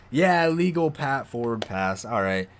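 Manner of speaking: ordinary talk, nothing marked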